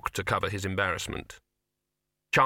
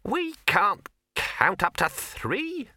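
high rabbit voice, slow and sour; sourly; 11 semitones higher, much slower than usual. Acted. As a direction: drag it out, sulky and sour. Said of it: "said the rabbit sourly".

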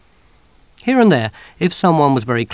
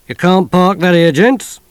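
raised, higher policeman's call; 7 semitones higher, 9 dB louder than usual. Acted. Policeman calling into the lorry, loud and high.

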